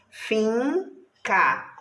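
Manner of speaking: Finca in two drawn-out syllables; syllables separated. Shown as a demonstration. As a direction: say Finca slowly, split as fin, ca. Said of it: "Ó, separado".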